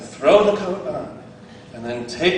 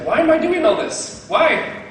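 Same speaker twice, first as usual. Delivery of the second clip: much higher, louder exasperated complaint; whiny kid; 11 semitones higher, 5 dB louder than usual. Retold or imitated. Karate Kid student complaining about chores, high and whiny. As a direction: whine it like a kid sanding and painting. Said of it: "It's like".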